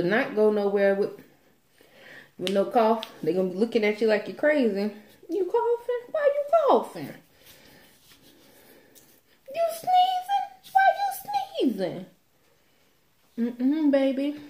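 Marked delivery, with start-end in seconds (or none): 5.3-14.4 nosy high voice. Retold or imitated. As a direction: imitate a nosy busybody. Imitates nosy people again.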